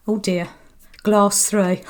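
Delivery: usual speaking voice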